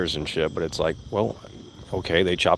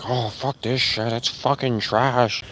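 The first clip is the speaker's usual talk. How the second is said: mock whining fan; 3 semitones higher, slower than usual. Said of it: "people start crying and whining about it".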